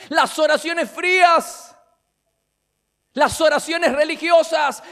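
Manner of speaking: loud, driving sermon voice, shouting key words; preaching at full force. Acted. Preacher condemning cold prayers to his congregation, shouting. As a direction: hammer each phrase home from the pulpit.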